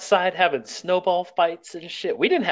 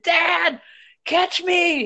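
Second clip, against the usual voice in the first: put-on kid yelling; much slower than usual. Kid yelling at his dad.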